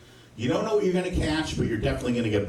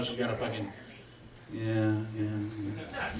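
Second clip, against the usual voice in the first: trailing lewd murmur; 4 semitones lower, 8 dB quieter, slower than usual. Retold or imitated.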